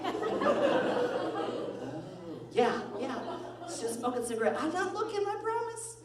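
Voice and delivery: very high squeaky protest; mock pleading excuse. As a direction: plead innocence in a comic high pitch. Acted pleading excuse in retold quarrel.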